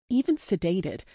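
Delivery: ordinary delivery, nothing marked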